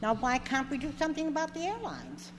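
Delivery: ordinary delivery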